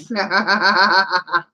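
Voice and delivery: rapid run of chuckles; laugh. Real, not acted. Streamer laughing after thanking Mort, amused laugh. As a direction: laugh it out in a quick string.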